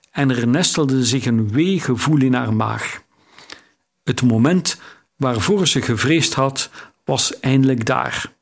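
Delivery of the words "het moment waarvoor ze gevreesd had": plain reading voice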